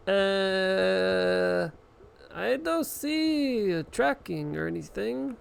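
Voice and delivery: high, drawn-out dithering customer-service voice; mock an unhelpful agent, slow and high; clueless phone agent. Mocking clueless phone agent's reply.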